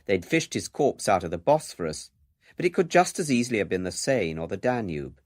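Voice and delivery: ordinary delivery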